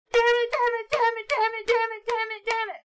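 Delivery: high, strained, repeated damn it; mock frustrated cries. Student alone in the bathroom letting off steam, mock cries. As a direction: vent like a kid stomping in a bathroom stall. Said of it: "you just hear loud cries of".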